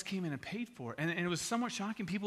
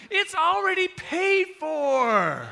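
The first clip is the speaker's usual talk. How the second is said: very high, booming, stretched-out cashier call; acted ecstatic reveal; excited announcement; 12 semitones higher, 11 dB louder, much slower than usual. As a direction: holler it triumphantly, holding the words long. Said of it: "they're all excited about it now".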